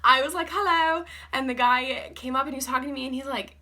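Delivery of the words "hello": put-on high, loud hello in an English accent; playful imitation English accent. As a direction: greet customers brightly in a faked English accent. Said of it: "you have to keep up that accent".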